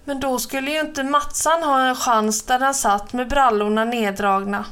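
ordinary delivery, nothing marked

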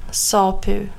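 usual speaking voice

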